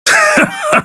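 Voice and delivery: short high sneering laugh; villain's laugh. Acted. God mocking a beaten Saiyan, short laugh. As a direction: scoff with a cold laugh.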